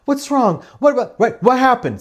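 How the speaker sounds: high, fast "what, what, what" of a frantic mother; fussing mother. Retold or imitated. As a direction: flutter over her like an anxious parent.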